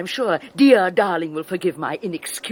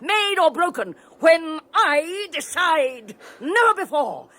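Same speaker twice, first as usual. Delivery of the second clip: building contempt; 9 semitones higher than usual. Acted. Henrietta's cruel tirade.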